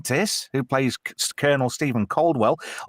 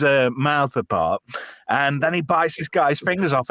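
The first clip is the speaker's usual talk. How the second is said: ordinary delivery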